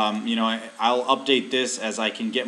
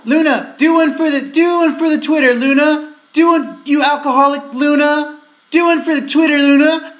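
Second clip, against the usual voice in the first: shouting at pet; 11 dB louder than usual. Real, not acted.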